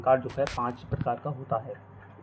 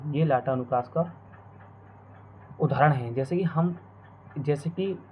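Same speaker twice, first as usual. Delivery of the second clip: ordinary talk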